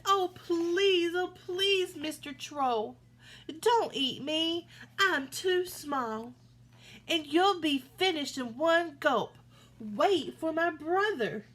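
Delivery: high, slow, trembling begging; pleading little goat. A narrator's reading. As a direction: beg for your life, high and slow. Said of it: "trembled where he stood".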